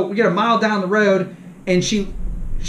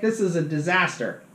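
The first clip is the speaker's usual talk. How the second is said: normal talking voice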